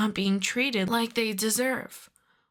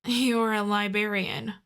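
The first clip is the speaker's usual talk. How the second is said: ordinary delivery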